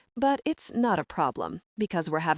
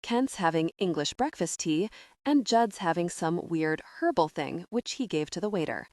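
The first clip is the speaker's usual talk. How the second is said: ordinary delivery, nothing marked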